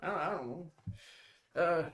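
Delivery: ordinary talk, nothing marked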